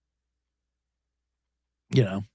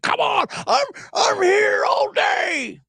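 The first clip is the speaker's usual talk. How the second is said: much higher, croaky frog-like yelling; Patrick Mahomes frog voice; much slower than usual. Retold or imitated. Mocking the quarterback's sideline audio in an absurd frog voice. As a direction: squeeze it out like a cartoon frog, yelling. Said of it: "It's always an absurd frog voice".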